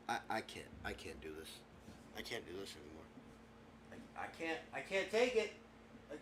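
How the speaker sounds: very soft, defeated stammer; exasperated muttering. Acted. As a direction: mutter it as if giving up. Host giving up in exasperation.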